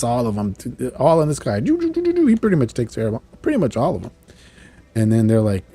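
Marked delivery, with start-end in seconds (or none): 1.6-2.3 high, rapid-fire "doo" sound effects; imitated blast noises. Retelling Bishop blasting demons in the sky, imitated blast noises. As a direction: mimic energy shots in a high voice.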